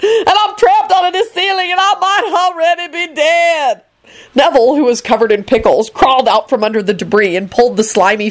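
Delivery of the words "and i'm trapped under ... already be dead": high-pitched wail of complaint; shrieking. A narrator's reading. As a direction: shriek it high, slowing toward the end. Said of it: "Lucretia shrieked".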